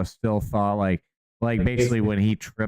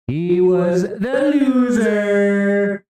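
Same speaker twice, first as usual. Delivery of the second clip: gloating taunt; 8 semitones higher, 5 dB louder, much slower than usual. Pretend. Gloating taunt in mock Trump persona.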